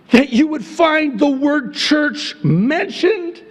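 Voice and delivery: high, sustained preacher's yell. Real, not acted. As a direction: yell the argument out as an incredulous rhetorical question. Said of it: "I'm sorry, I'm yelling".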